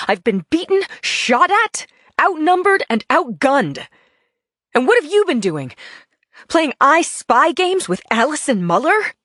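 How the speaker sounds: high, loud escalating rage; furious tirade. Acted. Wife to husband listing her injuries, furious and high. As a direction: build to a furious peak.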